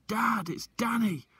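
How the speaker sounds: high, loud and slow calling; boy shouting through the night forest. Acted. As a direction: stretch the call out across the woods. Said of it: "I shouted".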